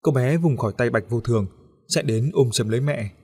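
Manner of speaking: ordinary delivery, nothing marked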